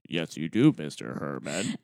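a stranger's voice dubbed in; dubbed-over voice. Retold or imitated. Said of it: "it's not his voice".

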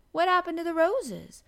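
put-on high, loud, eager girl's question; avidly curious child; keenly interested. A narrator's reading. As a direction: press for the answer with rising curiosity. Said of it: "more interested in than ever".

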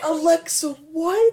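very high, incredulous cry; flabbergasted. Real, not acted. Joker stunned by co-host's revelation, flabbergasted. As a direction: squeal the question in disbelief. Shocked the joke is real.

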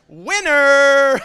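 high, loud laughing cry of winner; laughing triumph. Retold or imitated. The Lord playing the trump card, laughing triumphantly. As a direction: crow it through laughter.

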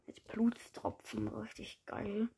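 breathy unvoiced whisper; hushed aside. Real, not acted. Aside while typing.

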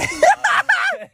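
real laughter spilling through the words; laughing hard. Real, not acted. Laughing at a silly joke, real laughter. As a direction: laugh out the line, barely able to speak.

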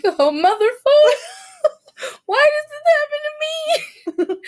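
high, whiny wail; mock despair. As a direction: wail it in comic dread. Mock despair at the noise returning.

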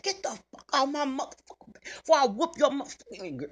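loud, high retold tirade; cussing out. Mother telling off boy messing with her baby, retold cussing out. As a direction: reenact cussing someone out. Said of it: "I cussed the little boy out".

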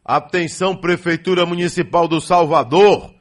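calling out. Real, not acted. Radio host calling out announcement.

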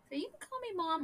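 high, sugary mother-in-law; a lightly mocking sweet high voice. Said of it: "I think my mother-in-law".

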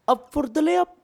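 high, excited play-by-play voice; mock commentator call. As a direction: call the block like a TV announcer. Mimicking a basketball commentator call.

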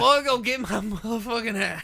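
put-on cranky old coot bossing the boy; old prospector. Old-timer bit.

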